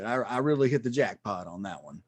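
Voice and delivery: ordinary delivery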